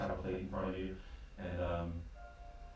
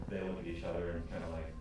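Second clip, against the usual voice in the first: ordinary talk, nothing marked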